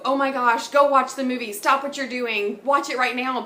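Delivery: loud, gushing push to go watch; eager urging. Pretend. Vlogger urging viewers to watch the movie now, loud and gushing. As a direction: insist the viewer drop everything and watch.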